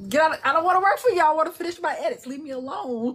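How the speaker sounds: frantic get-out rant; exasperated. Retold or imitated. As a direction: shoo the work away. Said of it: "And I'm like".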